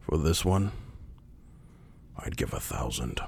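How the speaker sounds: pause, then sink almost to a whisper. Acted. Game master voices high priest.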